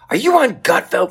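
very high, loud nosy question; loud lady. Retold or imitated. Customer service lady loudly asking the comedian about Gutfeld, high and loud. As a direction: blurt it out for the whole line to hear. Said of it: "She's like".

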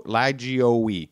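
Lagioe sounded out as "lazh-ee-OH-ee"; attempted old pronunciation. Shown as a demonstration. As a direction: sound out Lagioe in separate syllables, ending in "ee". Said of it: "Did your ancestors use this pronunciation?".

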